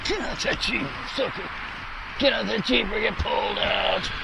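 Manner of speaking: mock road rage. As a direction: play the angry rider, half laughing. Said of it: "Road rage on a scooter".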